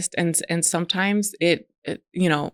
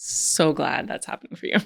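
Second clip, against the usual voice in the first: sarcastic. Retold or imitated. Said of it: "I'd be like".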